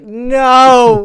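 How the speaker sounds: mock-anguished wail, high and loud; dismayed cry. Coach reacting to client's stupid remark to a girl, dismayed. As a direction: draw it out in exaggerated despair.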